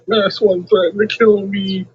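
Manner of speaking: high, mocking whiny voice of the rival; mocking imitation. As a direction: mimic the rival as a whiner. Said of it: "All you do is say".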